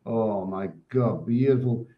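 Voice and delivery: ordinary talk, nothing marked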